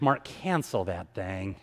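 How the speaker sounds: a friend voiced lightly, low and slow; a hint of the worried friend.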